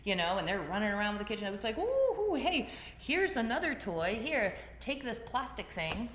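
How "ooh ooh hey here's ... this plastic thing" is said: put-on high bright singsong to distract a toddler; mock cheerful coaxing. Mother luring her child off the knife with a toy, high and cheery.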